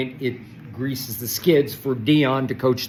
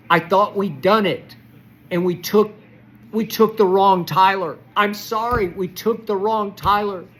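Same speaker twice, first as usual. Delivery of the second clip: upset yelling; 7 semitones higher, 4 dB louder than usual. Real, not acted. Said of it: "She doesn't understand why I'm yelling".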